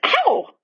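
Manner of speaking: high, startled "ow"; sudden yelp. A narrator's reading. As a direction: yelp it suddenly, pitched right up. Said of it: "made a noise like".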